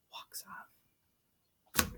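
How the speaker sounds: breathy stage direction whisper; whispered aside. Real, not acted. Narrating the queen's exit, whispered. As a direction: whisper it like a note.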